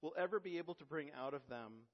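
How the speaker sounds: earnest, oratorical reading of Spurgeon; formal quotation reading. Acted. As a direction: read the old preacher's words with conviction. Reads Spurgeon quotation aloud.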